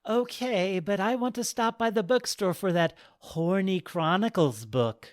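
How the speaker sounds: a hint of a cheeky old goddess; cheeky old lady. Goddess jokes leaving.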